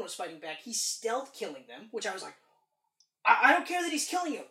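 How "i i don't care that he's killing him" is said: loud, high and quick with glee; excited outburst. Retold or imitated. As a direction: gush over the action scene. Retold excited reaction.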